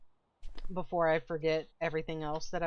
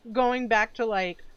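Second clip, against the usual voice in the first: mouth full; 4 semitones higher, 6 dB louder than usual. Real, not acted. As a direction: still chewing chicken. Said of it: "sorry, I'm eating chicken".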